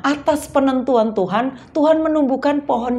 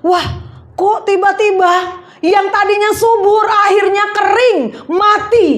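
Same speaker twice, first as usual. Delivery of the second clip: high-pitched acted shock; hammed-up astonishment; startled; 8 semitones higher, 3 dB louder than usual. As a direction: exclaim in dismayed disbelief, as if caught off guard. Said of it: "dia kaget".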